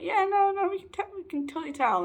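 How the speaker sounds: high-pitched eager reassurance; friends insisting. Retold or imitated. Friends assure the speaker they can tell, high insistent imitation. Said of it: "And they're like".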